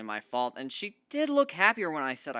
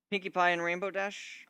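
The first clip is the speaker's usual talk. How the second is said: plain reading voice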